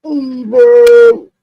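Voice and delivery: high, loud outburst; loud exclamation. Real, not acted. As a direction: burst out with the word.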